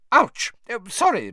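high, loud yelp then a flustered apology; sudden high cry, then sorry; startled ouch. Acted. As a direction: caught off balance mid-fall. McGonagall skids, grabs Hermione.

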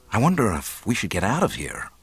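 ordinary delivery

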